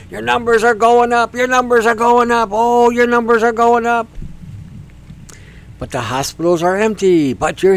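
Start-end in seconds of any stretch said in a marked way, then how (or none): 0.1-4.1 higher, louder, hammed-up panic; mock alarm. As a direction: chant it like a frantic newscaster. Mocking alarm about numbers.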